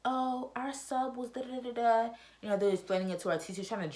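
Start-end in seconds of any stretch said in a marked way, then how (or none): 0.1-2.1 put-on slow, higher gossipy kid voice; mocking classmate. Classmate telling the teacher about the sub, mocking. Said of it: "it was like".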